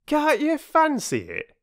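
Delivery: very high-pitched sarcastic porter; indignant working-man's squawk. A narrator's reading. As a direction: scoff in injured outrage. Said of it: "said Perks indignantly".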